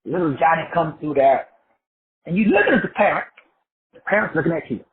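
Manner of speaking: usual speaking voice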